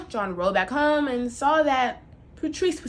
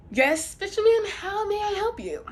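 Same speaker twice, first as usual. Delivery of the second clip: a fish voice, higher and airy; lightly voiced magical fish; 9 semitones higher than usual. Magic fish answering the fisherman, a lightly high voice.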